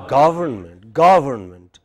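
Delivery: "Government" as GAV-ern-ment, main stress on GA; correct pronunciation. Shown as a demonstration. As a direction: stress the first syllable of "Government". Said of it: "What is it".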